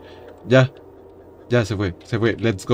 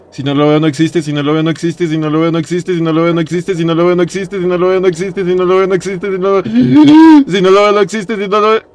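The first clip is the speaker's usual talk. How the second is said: nonstop chant rising higher and louder; panicked mantra; 5 semitones higher, 4 dB louder than usual. Real, not acted. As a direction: repeat without a breath, building to panic. Panicked self-soothing mantra while hiding. (mantra de pánico, cada vez más agudo)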